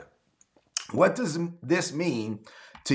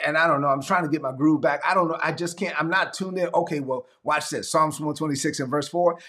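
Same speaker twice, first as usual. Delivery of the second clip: ordinary delivery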